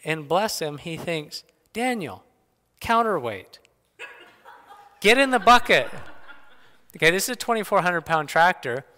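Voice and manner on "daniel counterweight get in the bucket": imitated eager call, higher, building louder; excited bright idea. Boy telling his brother his plan, acted excited call. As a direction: pitch it up like a sudden brainwave, ending loud.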